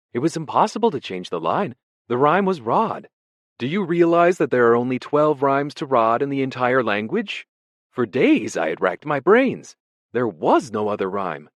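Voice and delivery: high, loud, fluttery scholar's voice; a vague, startled prisoner greeting an old colleague; nervous poet. Acted. Ampleforth greets Smith in the cell, high and startled.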